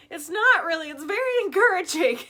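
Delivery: loud, high retold wail; mock bawling protest. Mom retells answering son while bawling, loud mock-tearful wail. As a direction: wail it loudly, imitating tearful denial.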